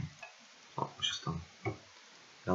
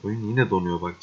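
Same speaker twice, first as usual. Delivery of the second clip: usual speaking voice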